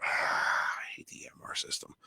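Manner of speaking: sighing whisper, slow and hushed; whispered grumble. Real, not acted. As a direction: mutter it under your breath, fed up. Grumbling aside into mic.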